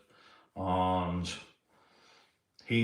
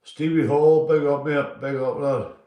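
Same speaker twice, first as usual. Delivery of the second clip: loud shout-out; 4 semitones higher, 8 dB louder than usual. Real, not acted. Shouting out a chat viewer.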